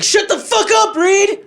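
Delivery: high-pitched mock furious shout; mad yell. Imitating a mad friend yelling at Reed, angry high shout. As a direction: imitate a friend blowing up in anger. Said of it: "he's gonna get all mad".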